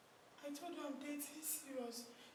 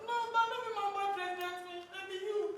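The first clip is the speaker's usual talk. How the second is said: loud protest; 6 semitones higher, 9 dB louder than usual. Acted. Girlfriend protests loudly.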